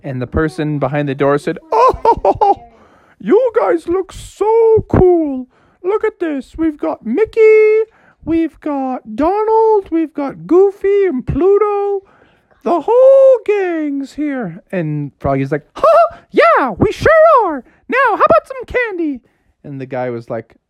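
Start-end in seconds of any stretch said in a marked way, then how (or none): 1.7-14.6 much higher cheerful grown-up voice; high delighted neighbour admiring kids. Acted. 15.7-19.2 much higher cartoon frog; eager frog asking for candy; high squeaky Froggy; Froggy's voice. Froggy answers neighbour.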